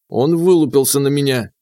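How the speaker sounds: plain reading voice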